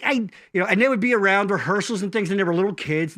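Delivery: ordinary talk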